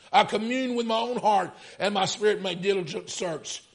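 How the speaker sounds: high, loud preaching cry; preaching at full force. Acted. Preacher retelling the story, full-force preaching.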